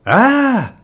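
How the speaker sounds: loud, very high scream; startled yell. A narrator's reading. Father turns round to a painted face behind him, startled yell. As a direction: scream as if someone just jumped out at you. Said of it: "His father turned around and yelled".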